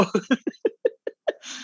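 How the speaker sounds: breathy laugh. Real, not acted.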